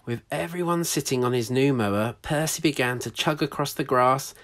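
lively read-aloud storytelling voice; story narration. A narrator's reading. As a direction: read it like a picture book to children.

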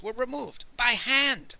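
touch of wounded pride; huffy. A narrator's reading. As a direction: insist on it, mildly offended. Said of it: "Master Li said huffily".

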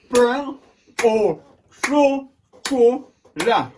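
high, loud "Pain au chocolat" in five separate beats; syllable by syllable. Shown as a demonstration. Teacher tries out the longer name, syllable by syllable. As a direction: punch out each syllable of "Pain au chocolat" slowly. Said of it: "Alors, on va essayer".

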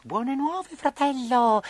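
high puppet voice, encouraging; hopeful Harlequin. Acted. Harlequin to Pinocchio after the sneeze, high and hopeful. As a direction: share good news in a little puppet voice.

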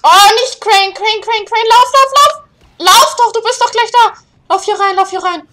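high, loud run run run; frantic urging shout. Real, not acted. Gamer screams at Crane to run to safety. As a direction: drive the character on in full panic.

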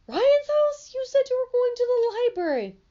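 high, loud disbelieving cry; shocked outrage. Acted. Mother shocked her son lied about the library. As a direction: voice leaps up in angry disbelief.

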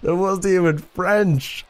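high-pitched, laughing incredulity; amused disbelief. Real, not acted. Player told that French accent was sexy, laughing disbelief. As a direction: break into a laugh, then protest slowly up high.

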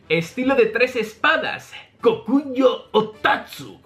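measured anime battle cry; Zoro. Acted. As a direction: announce a sword technique dramatically. Zoro's attack cry.

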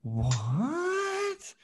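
high, drawn-out what; playful disbelief; mock surprise. Real, not acted. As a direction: act shocked at the answer. Student says he doesn't like tennis.

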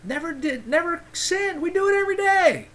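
preacher climbing to a fervent peak; emphatic. Real, not acted. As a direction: build it up from never to every day. Emphatic claim that Christ never sinned.

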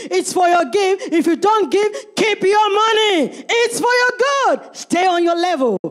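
loud, high preaching shout; shouting preacher. Acted. Preacher shouting at congregation about giving. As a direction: cry it out hard at the crowd.

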